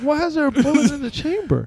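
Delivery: high-pitched baffled question; retold reaction, high and incredulous. Retelling own baffled reaction to story.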